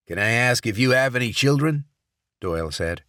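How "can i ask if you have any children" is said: loud, urgent senator; senator, tense but reasoning. Acted. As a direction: try to talk her out of it. Senator asks.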